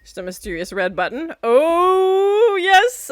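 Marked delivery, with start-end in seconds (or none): 1.4-3.1 high delighted oh yes; gleeful, pitched up; excited. Real, not acted. Delighted by a red button.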